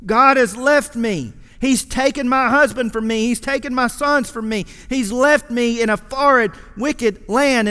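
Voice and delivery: preacher pressing the congregation on Naomi's bitterness; fervent preaching. Acted. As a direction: drive the point home from the pulpit. Preaching with rising force.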